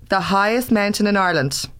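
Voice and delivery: ordinary talk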